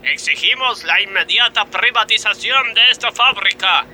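high, declaiming demands; protest proclamation. Acted. Striking worker declares demands to the boss. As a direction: proclaim it like a union manifesto.

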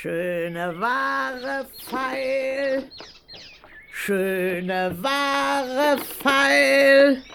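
put-on slow, drawn-out old hawker's call; old peddler woman. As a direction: cry your wares like an old crone. Queen disguised as old peddler.

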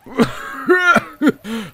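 high, unearthly laughter; eerie laugh. Acted. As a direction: laugh like an unseen spirit. Monster laughs in the dark. (жуткий смех)

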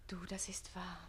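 whispered, earnest admission; breathy confession. Acted. Page admitting to Susanne, whispered confession.

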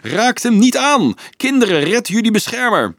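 very high, loud, urgent cry; desperate shout. A narrator's reading. Friend begging children to save their protector, desperate shout. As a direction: cry out to stop a fight. Desperate plea amid the fighting.

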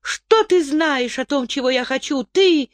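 lightly voiced indignant young woman; indignant girl. A narrator's reading. Zarit flaring at Tirl.

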